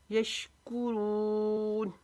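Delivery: यशकुरून slowly, short कु, रून held long. Shown as a demonstration. Teacher demonstrating madd, यशकुरून with a long held रून. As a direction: draw out the end of यशकुरून. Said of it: "रून को लंबा करेंगे".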